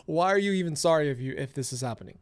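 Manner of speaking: ordinary talk, nothing marked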